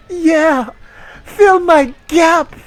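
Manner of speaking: high, loud, slow hammed-up moan; mock moan. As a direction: overplay the sultry line for laughs. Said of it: "she moaned".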